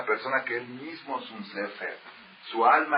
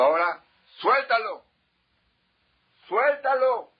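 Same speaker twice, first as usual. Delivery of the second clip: loud, high command to drop it; the rabbi shouts at the young man to drop the scroll; shouting order; 13 semitones higher, 7 dB louder than usual. Retold or imitated. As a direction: yell at him to let it go. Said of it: "Y le gritó tanto".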